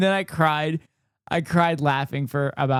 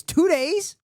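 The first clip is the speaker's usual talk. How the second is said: incredulous; 12 semitones higher, 3 dB louder than usual. Real, not acted. Shocked at speed.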